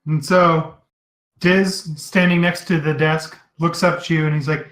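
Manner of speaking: normal talking voice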